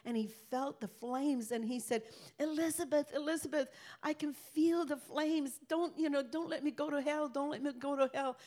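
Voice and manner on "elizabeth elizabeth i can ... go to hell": high, pleading cry to his wife, voice lifted high; desperate plea. Retold or imitated. Grandpa in the flames begs his wife Elizabeth, desperate and high. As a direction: beg her not to let him burn.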